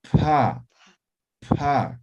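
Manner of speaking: "Pa" with a strong puff of air; aspirated pa. Shown as a demonstration. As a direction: blow out the "pa" as you release it. ("pa" aspirada, soplando)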